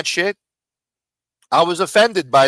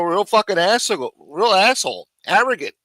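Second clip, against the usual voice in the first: ordinary talk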